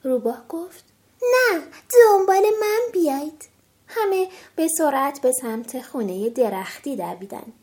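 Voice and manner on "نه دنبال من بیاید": higher, louder urgent call. Acted. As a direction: call the others to follow. Fox leading them in storm.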